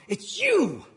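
a bitter soldier turning on his commander; angry accusation. Retold or imitated. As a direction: accuse him, heated and loud. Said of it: "one of them going".

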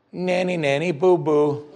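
high, singsong playground taunt; hammed-up nyah-nyah jeer; mock taunt. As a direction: tease like a gloating kid. Mock taunt Daniel does not say.

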